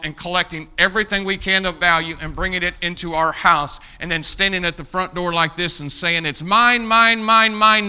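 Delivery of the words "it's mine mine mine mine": loud, higher possessive cries of mine; greedy "mine". Pretend. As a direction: clutch it and claim it loudly. Greedy American at front door.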